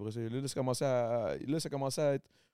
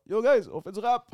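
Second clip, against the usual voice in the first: high, loud hammed-up hail; mock calling out; 9 semitones higher, 5 dB louder than usual. Cornered Quebec rappers hailing the bigger markets, mock eager shout. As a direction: call out like an overeager little newcomer. Acts small scene hailing the world.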